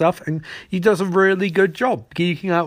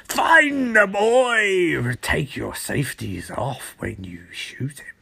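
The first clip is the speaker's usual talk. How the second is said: villain command; 3 semitones lower, slower than usual. Retold or imitated. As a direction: bark the order slowly, then sink to a menacing hush. Trickster giving villain orders.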